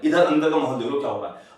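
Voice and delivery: normal talking voice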